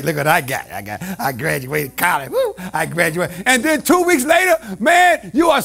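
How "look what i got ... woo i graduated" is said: much lower, softer bragging voice; mock proud graduate. Preacher imitating a graduate showing off, lower.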